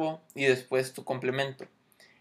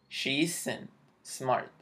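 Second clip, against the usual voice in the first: very slow; 5 dB quieter, much slower than usual. Shown as a demonstration. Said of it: "Ella no es inteligente".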